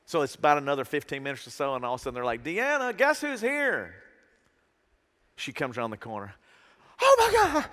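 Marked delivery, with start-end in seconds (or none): 2.4-4.0 high, slow singsong call; teasing call-out. Retold or imitated. Staff calling out to Deanna. 7.0-7.7 very high, loud shriek of excitement; fan scream. Retold or imitated. Deanna sees him, screams.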